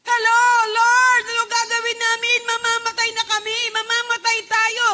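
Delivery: loud, high panicked cry; frantic shout for help; panicked disciples. Acted. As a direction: cry out in terror to the Lord. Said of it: "Nagpapanik na sila".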